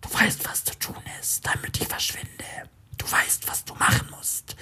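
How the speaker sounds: cold, cynical whisper. A narrator's reading. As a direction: whisper it like a mocking inner voice. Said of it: "spricht die Stimme zynisch".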